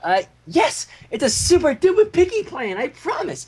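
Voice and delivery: high, eager, promising pitch; eager insistence. Acted. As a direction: convince them it's great.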